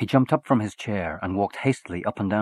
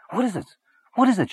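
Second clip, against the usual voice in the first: high, rising panic, louder on the repeat; alarmed cry; 13 semitones higher, 5 dB louder, faster than usual. Acted. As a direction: burst in frightened for the child. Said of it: "she cried".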